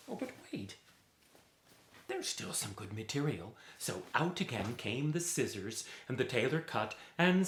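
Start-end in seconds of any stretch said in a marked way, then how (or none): 0.1-3.5 soft, hushed realization; sudden idea. Acted. Tailor spots good material left, hushed surprise. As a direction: catch yourself, then hush.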